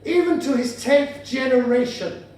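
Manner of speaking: slow, solemn Bible-reading delivery; scripture reading. Acted. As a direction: intone it like a verse from the pulpit, spaced out. Reads a Bible verse aloud.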